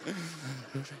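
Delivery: a quiet burst of laughter at the slip; real laugh. Real, not acted. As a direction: laugh at your own fumble. Laughs after fluffing verse.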